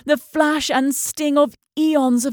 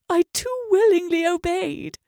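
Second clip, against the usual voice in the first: ordinary delivery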